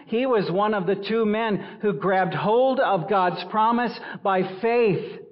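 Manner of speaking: loud, high, driving pulpit voice; forceful preaching. Acted. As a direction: thunder the verse at the congregation.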